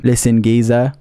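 low, loud mock British geezer; British accent. Shown as a demonstration. Streamer proves his fluency to chat, mock British accent. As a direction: show off a put-on British accent. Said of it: "that's how fluent I am".

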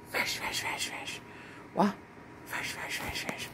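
soft, unvoiced whispered chant. Whispering fresh at the kitten. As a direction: whisper it over and over.

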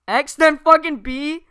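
high, loud exasperated shout; frustrated gamer yell. Pretend. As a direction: yell at the controls. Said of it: "swear a ton".

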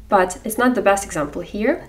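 usual speaking voice

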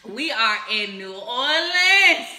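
slow, loud, stretched-out proclamation; drawn-out celebratory holler; hyped announcement. Real, not acted. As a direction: announce the city with party swagger. Announcing the party city.

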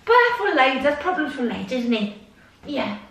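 normal talking voice

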